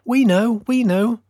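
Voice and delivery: very high, birdlike chirp; chirping sparrows. A narrator's reading. Sparrows answering the fir tree, high and chirpy. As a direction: pipe up like eager little birds. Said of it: "they chirped as they huddled".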